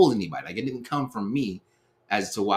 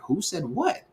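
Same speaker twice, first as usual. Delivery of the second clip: who said what, higher and stunned; shocked disbelief; 8 semitones higher, slower than usual. Retold or imitated. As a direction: pitch jumps high in disbelief. Shocked at being blamed.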